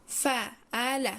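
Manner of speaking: فعل stretched very slowly, each sound held; a teacher slowly drawing out فعل; slowly drawn out. Shown as a demonstration. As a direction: drag out فعل so the letters can be heard.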